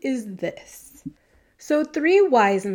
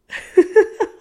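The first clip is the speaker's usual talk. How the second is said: big laugh bursting out; loud burst of laughter; 11 semitones higher, 9 dB louder than usual. Real, not acted. Laughs at own joke.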